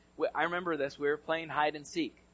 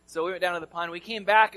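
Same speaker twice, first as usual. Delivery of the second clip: ordinary delivery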